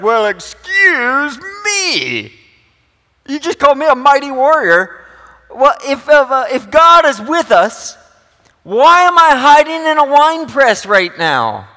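high, loud mocking Gideon voice; cheeky and sarcastic. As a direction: throw it back at the angel with sarcasm. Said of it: "we need to read cheeky and sarcastic".

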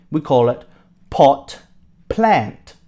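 "pot" and "plant" split apart with a pause between; slow model word. Shown as a demonstration. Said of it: "Say it with me".

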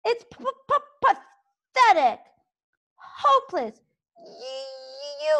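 high, stammering, gleeful taunts; taunting little dragon. A narrator's reading. Toothless mocking Fireworm with stuttered insults. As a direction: pile on the insults, high and cheeky.

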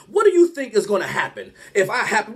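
normal talking voice

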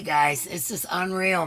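ordinary delivery

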